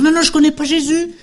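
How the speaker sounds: high, loud panicked denial; Peter's denial. Retold or imitated. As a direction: deny it in a high, rushed voice. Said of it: "Pierre, qui veut dire". (Pierre qui nie, voix haute et affolée)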